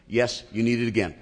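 ordinary talk

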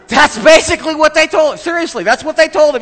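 very high, loud protest; loud, high, amused insistence; high-pitched insistence. Real, not acted. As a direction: insist loudly in a squeaky-high voice. Laughing, insists on his retelling.

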